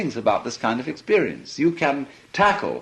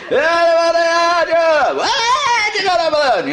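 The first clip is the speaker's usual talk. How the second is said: much higher, louder preaching cry; revivalist preacher; 8 dB louder than usual. Retold or imitated. Imitating the preacher's climax on the radio, loud and high.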